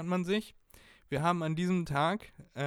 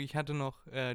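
usual speaking voice

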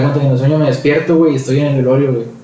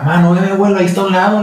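pitched up, urging his mother to look; urgent call to mom; 6 semitones higher, much faster than usual. Retold or imitated. Son urging his crying mother to look at grandpa, pitched up. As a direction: insist she see the grandfather standing there.

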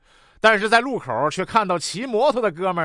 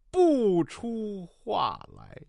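very slow, softer, sinking away; deflated reading; 6 dB quieter, much slower than usual. Acted. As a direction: let the grand reading die out word by word. Realising the page turn blunder.

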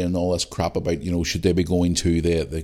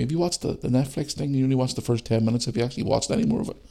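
a hint of a higher questioning voice; lightly voiced nagging fans; 6 semitones higher, faster than usual. Imitating fans pestering him, lightly voiced higher. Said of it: "people keep asking me".

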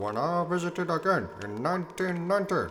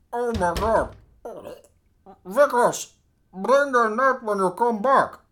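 ordinary talk, nothing marked

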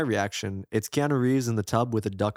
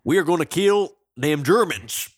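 Brad Pitt impression; 5 semitones higher, 5 dB louder than usual. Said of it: "I like when Brad Pitt".

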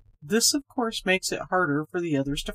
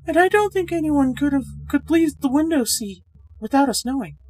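higher, brighter-pitched woman, chatty and a little uneasy; Miss Gorman's voice; 9 semitones higher, 7 dB louder than usual. A narrator's reading. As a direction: play the friendly young woman, higher and louder.

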